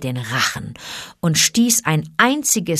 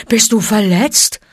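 higher, louder worried question; concerned bear; 4 semitones higher, 5 dB louder than usual. A narrator's reading. Bear checks on the rescued boy, concerned. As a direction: ask with anxious care. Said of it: "ihn zärtlich betastend".

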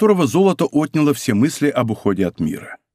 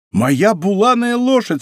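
high, drawn-out delighted cry; surprised and overjoyed, voice soaring; joyful astonishment; 7 semitones higher than usual. A narrator's reading. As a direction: exclaim in amazed recognition. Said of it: "вскричал от удивления, смешанного с радостью".

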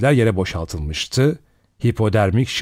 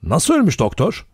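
impatient question, pitched up; higher-pitched, impatient demand for an answer; 7 semitones higher than usual. A narrator's reading. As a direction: ask it with impatience, voice lifting. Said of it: "sabırsızca".

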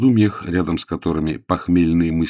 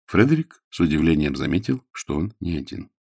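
plain reading voice

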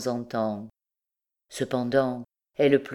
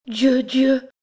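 high, loud, astonished cry; overwhelmed shout, pitched high; shocked exclamation; 10 semitones higher, 7 dB louder than usual. A narrator's reading. Girl's shock at seeing the shoe.

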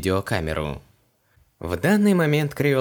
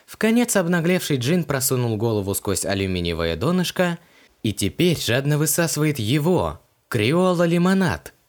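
ordinary delivery

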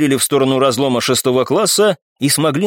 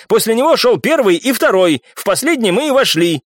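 high-pitched indignant tirade; heated shouting; 8 semitones higher than usual. A narrator's reading. Viscount justifying himself to the captain, heated outburst. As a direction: argue back hotly. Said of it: "не менее громко прокричал виконт".

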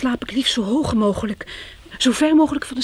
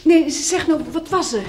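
insistent pressing; 4 semitones higher, 6 dB louder than usual. Acted. Pressing him about the flat.

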